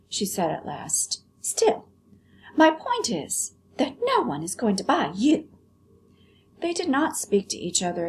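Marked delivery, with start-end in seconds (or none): 1.4-5.5 high prissy doll voice; haughty doll, very high; vain china doll. Acted. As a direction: look down your nose at the rabbit.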